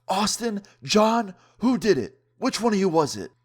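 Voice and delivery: high, loud imitation of an accusing teacher; stern teacher demanding answers. Teacher confronting two students over a vape cloud, accusing.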